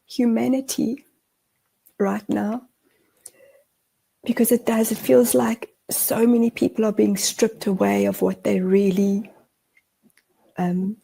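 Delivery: holding back tears; teary. Real, not acted. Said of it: "I'm getting teary".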